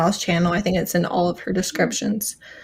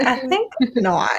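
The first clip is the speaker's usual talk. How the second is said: prim refusal; 5 semitones higher than usual. Retold or imitated. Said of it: "She's just like".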